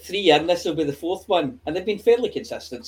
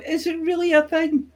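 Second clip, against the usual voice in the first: doubtful fans; 12 semitones higher than usual. Retold or imitated. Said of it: "people talking about ... and going".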